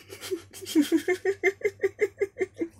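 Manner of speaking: long, high rippling giggle. Real, not acted. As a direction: giggle helplessly in a high, quick run. Giggling at her own silly blunder.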